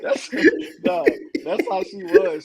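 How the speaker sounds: ordinary delivery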